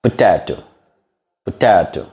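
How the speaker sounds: British Potato with a crisp T; demonstrating British pronunciation; British accent. Shown as a demonstration. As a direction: say Potato the British way, each T sounded. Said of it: "British accent".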